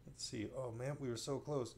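usual speaking voice

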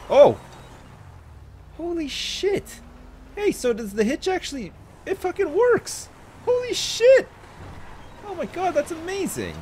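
genuinely thrilled, voice jumping up; real excitement. Real, not acted. Player amazed the trailer hitch works, high excited outburst.

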